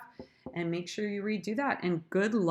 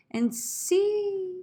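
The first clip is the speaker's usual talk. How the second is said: much higher, drawn-out "see"; high pitch; 13 semitones higher, 4 dB louder than usual. Shown as a demonstration. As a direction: lift the word SEE way up high. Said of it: "go high in pitch".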